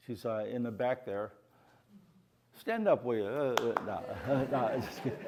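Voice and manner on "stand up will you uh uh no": ordinary talk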